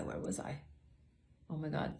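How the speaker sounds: whispered aside to herself. Real, not acted. To herself after losing her place, a whisper. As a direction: breathe it out, searching for the thread.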